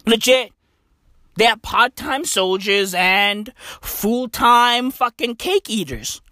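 ordinary talk

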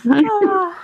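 a high, loud, drawn-out oh of embarrassed disbelief; exasperated oh. Real, not acted. Co-host reacting to their own silly Irish story, in a high loud exasperated oh.

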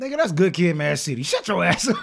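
loud comic shutdown, rising toward a laugh; mock scolding. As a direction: play the heckler killing the idea. Acts inner heckler rejecting movie idea.

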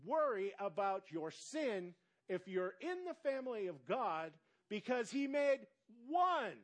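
loud, high, ringing sermon climax; preaching at full force. Acted. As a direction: hammer home one sacrifice to the church.

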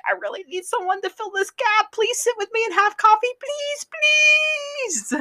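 very high, put-on begging voice; mock desperate pleading. Ron begging a suitor for company, hammed-up pleading. Voicing Ron's imagined desperate need.

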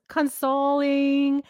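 "consoling" drawn out slowly as con-SOH-ling; mispronunciation attempt. Shown as a demonstration. Host shows co-host her wrong guess, halting mispronunciation of "consoling". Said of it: "It was like cons".

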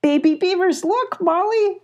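high, thrilled cry of discovery; excited exclamation. A narrator's reading. Abby spots the baby beavers, exclaiming excitedly. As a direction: exclaim with voice leaping up. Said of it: "exclaimed Abby".

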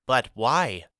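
lightly voiced boy's puzzled question; a child not understanding, asking simply; small boy asking. A narrator's reading. Pip asking Mama why she must stay, lightly childlike.

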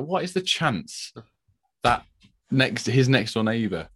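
slow, high disbelief; drawn-out mock amazement; amazed. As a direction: marvel slowly at the neighbour coincidence. Amazed at the coincidence.

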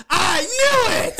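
loud, high, drawn-out I knew it; triumphant outburst. Retold or imitated. Catching the plot twist in the theater, a loud triumphant shout. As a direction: shout it out like you called it all along.

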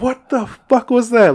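high, loud startled yell; re-enacted shriek of alarm; retold scream. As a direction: scream it as you did then. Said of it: "Like, I screamed".

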